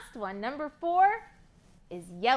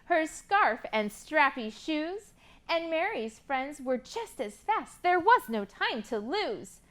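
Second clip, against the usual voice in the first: rhyming picture book read with lively lilt; reading to children; slower than usual. Acted. Teacher reading a rhyming book to kids, lively. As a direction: read the rhymes to a class of kids.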